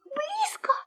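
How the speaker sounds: very high, small anxious squeak; tiny alarmed mouse voice; worried little mouse. A narrator's reading. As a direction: squeak it softly, nervously. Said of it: "заволновался мышонок".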